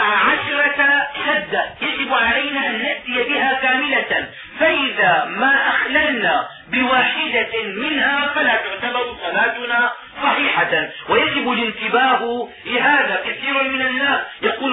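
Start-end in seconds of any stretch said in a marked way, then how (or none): none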